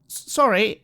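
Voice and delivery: mildly bewildered; a little confused. Acted. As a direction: caught off guard, apologise haltingly. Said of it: "looks a little confused and says".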